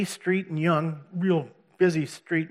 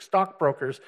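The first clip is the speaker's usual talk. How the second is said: normal talking voice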